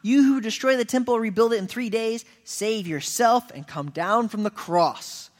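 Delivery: sneering crowd taunt; mocking taunt. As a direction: jeer at him from the crowd. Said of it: "wagging their heads and saying".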